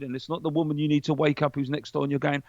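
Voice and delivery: normal talking voice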